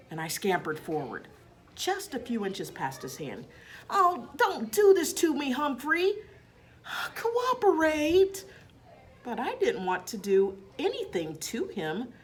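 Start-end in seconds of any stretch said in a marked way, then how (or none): 3.9-8.5 higher, pleading, with a slow drawn-out Cooperate; exasperated plea. A narrator's reading. Principal begs the escaping hamster to cooperate, exasperated. Hamster dodges his hand.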